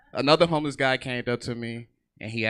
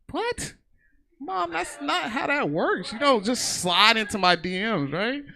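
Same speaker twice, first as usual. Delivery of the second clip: much higher, slow appalled protest; 10 semitones higher, slower than usual. Retold or imitated. Son protesting to mom about DMs, high and appalled. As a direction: whine it at mom in disbelief. Reacting to mom's DMs remark.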